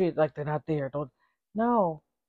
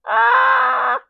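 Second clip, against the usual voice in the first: high, delighted shriek; a sudden scandalized squeal; 6 dB louder than usual. Real, not acted. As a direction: squeal at the punchline. Shrieking at the quote.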